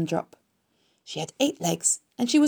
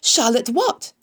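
raised, louder eager question; 5 semitones higher, 5 dB louder, slower than usual. A narrator's reading. Pig wanting the spider's full name, eager. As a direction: ask keenly, voice lifted. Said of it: "asked Wilbur eagerly".

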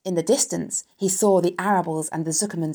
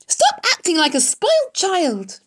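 high, loud scolding; exasperated scream; 13 semitones higher, 6 dB louder than usual. A narrator's reading. Wilbur scolds the lounging rat, exasperated scream. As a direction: yell it in frustration. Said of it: "screamed Wilbur".

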